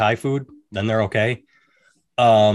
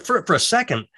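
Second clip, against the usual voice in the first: ordinary delivery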